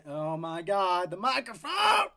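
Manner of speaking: shocked at the mic; real startled shout at the microphone; surprised shout. Real, not acted. Notices the microphone problem.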